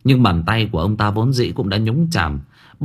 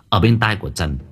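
ordinary delivery

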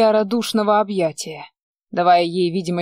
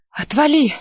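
sharp high angry yell; furious yell; 8 semitones higher, 3 dB louder than usual. A narrator's reading. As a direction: bark it at him in rage. Cornered woman yells at pursuer.

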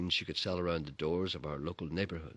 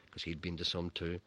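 ordinary delivery

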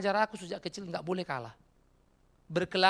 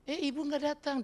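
office worker imitation; 8 semitones higher than usual. Staff noting the boss is absent, high put-on voice. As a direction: play a clerk passing on office news, pitched up.

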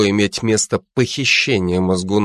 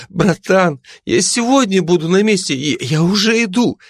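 put-on drunk pleading; 8 semitones higher than usual. A narrator's reading. Пьяный Колобок уговаривает друга.

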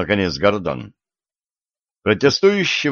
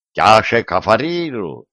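deutscher Akzent; slower than usual. Acted. German guest Willy exclaims.

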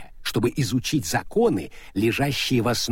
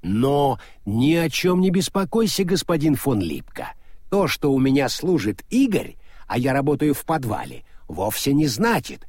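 usual speaking voice

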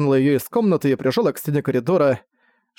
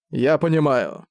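plain reading voice

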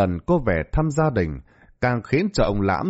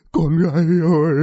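louder, higher crying call; father's wail rising into a cry; 6 semitones higher, 5 dB louder than usual. A narrator's reading. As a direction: wail the name of the daughter louder. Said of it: "đứng ngoài mà gào khóc".

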